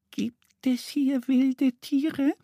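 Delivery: timid little duck, very high and slow; shy. A narrator's reading. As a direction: ask hesitantly in a tiny high voice. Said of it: "fragt sie schüchtern". (schüchternes, hohes Entenstimmchen)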